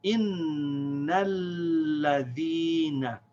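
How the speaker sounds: "Innal ladhina" slow, long n, long l, long ee; slow recitation demo of "Innal ladhina"; connected reading. Shown as a demonstration. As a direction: stretch and join "Innal ladhina". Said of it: "The lam connects with the noon".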